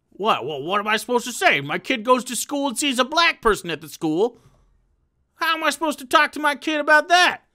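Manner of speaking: exasperated parent impression. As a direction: play a worried, put-upon parent, voice rising.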